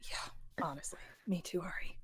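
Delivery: very soft, whispered agreement; confiding whisper. Acted. Leon secretly agreeing with Ari, whispering. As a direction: whisper it like a secret between two.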